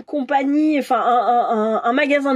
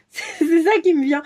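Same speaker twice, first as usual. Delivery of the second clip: laughing through the words; real amused laughter; 4 semitones higher than usual. Real, not acted. Card reader amused by what comes to them, laughing. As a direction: let a giggle break into the start.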